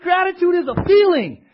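high, loud preacher's shout; fired-up, insistent proclamation; emphatic protest. Real, not acted. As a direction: cry it out with full conviction. Preacher refuting fear of feelings.

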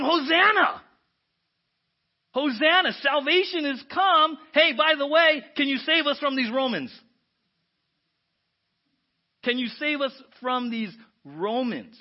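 loud, high crowd cheering; excited crowd. Retold or imitated. Crowd cheers Jesus entering Jerusalem, loud and high. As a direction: cheer him into the city. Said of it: "Jesus is entering, and they're saying".

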